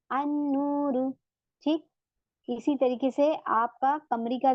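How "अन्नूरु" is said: अन्नूरु slow, with a doubled n and no l; silent lam. Shown as a demonstration. Teacher shows the students a sun letter. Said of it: "तो शमसी है".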